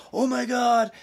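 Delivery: high, loud starstruck gush; imitating a thrilled fan, high and loud; giddy excited squeal. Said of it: "so giddy and excited".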